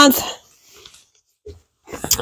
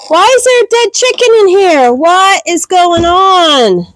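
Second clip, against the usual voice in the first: gasp, then high-pitched dismay; shocked; 8 semitones higher than usual. Real, not acted. Owner finding a dead chicken in the barn, shocked. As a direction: draw out the last question in high, real shock.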